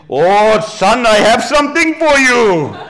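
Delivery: high, loud, slow hammed-up Anglo-Indian voice; Anglo-Indian accent. Preacher jokes as an Anglo-Indian relative, hammed-up Anglo-Indian accent. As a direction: draw it out loudly in a comic Anglo-Indian accent. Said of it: "my other Anglo-Indian".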